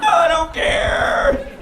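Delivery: high, long drawn-out wail; mock desperate cry. Allergic reaction at birthday outing, desperate drawn-out cry. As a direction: stretch it out in hammed-up desperation. Panicked retort at the horse show.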